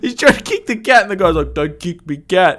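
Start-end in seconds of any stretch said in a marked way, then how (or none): 1.5-2.6 retold protest. Cat owner protesting, retold.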